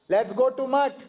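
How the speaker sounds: mock lion roar of a commanding guru; lion's roar. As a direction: roar it like an old lion rallying his disciples. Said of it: "roaring like a lion".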